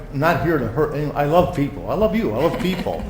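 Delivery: ordinary delivery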